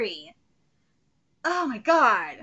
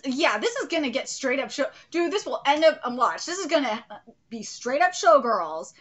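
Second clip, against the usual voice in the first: usual speaking voice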